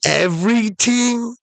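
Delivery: very high, incredulous rising question; amazed question. Acted. Castaway questioning the genie's offer, very high amazed voice. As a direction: squeak it up in disbelief. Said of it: "And the guy's like".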